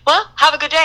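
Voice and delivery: high, sweet send-off; lightly imitated friendly wish; cheery polite greeting. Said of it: "I'd be like".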